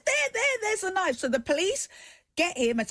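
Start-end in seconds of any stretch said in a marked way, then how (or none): 0.1-1.2 sudden high urgent cry, pointing at the knife. Retold or imitated. Woman tells the police the knife just fell, urgently. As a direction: jab it out high, there it is.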